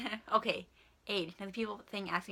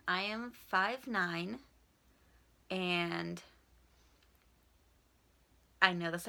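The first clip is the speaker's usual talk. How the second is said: ordinary delivery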